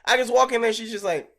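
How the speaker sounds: ordinary talk, nothing marked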